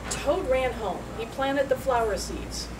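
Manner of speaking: ordinary delivery, nothing marked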